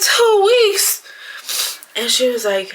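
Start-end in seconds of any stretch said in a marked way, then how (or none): 0.0-1.0 high-pitched, incredulous outburst; shocked disbelief. Retold or imitated. Patient echoing the midwife's two weeks of bleeding, shocked. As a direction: re-enact your startled reaction, pitch leaping up.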